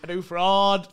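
a light impression of the drive-thru woman; quoting the viral woman. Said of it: "the clip of that wank woman".

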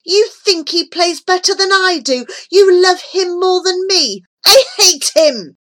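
high, loud, jealous outburst; howling. A narrator's reading. Jealous Remus to his grandfather, high angry howl. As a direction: howl with wounded fury. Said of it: "Remus howled through the notes".

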